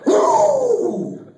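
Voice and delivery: high, shouted woo; long exultant cheer; loud whoop. Acted. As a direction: whoop at full volume. Acts out the fantasy catch.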